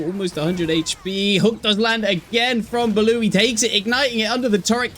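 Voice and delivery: high, loud caster call; excited play-by-play. Acted. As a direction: build the call to a peak. Live play-by-play of a fight.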